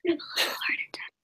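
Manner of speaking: soft, breath-only whisper. Real, not acted. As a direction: whisper it, speeding up at the end. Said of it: "What am I whispering?".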